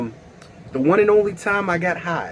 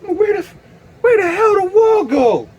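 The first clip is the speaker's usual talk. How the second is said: high-pitched panicked cry; panicked disbelief. Acted. Tripping storyteller freaks out at vanished wall, high panicked cry.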